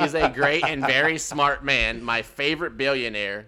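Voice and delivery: loud, slow, loosely held robot. Acted. Chatbot praising its favorite billionaire, loud robot voice. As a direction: read it out like a keen machine. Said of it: "talk in the robot voice".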